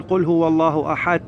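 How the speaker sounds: أحد wrongly ending in a "t" sound; final dal as T. Shown as a demonstration. Teacher shows the mistake to avoid, dal hardened to T. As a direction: turn the د of أحد into a ت. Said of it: "نسوي ت".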